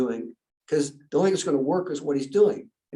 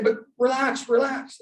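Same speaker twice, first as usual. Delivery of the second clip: retold placating; 7 semitones higher than usual. Calming his panicking wife.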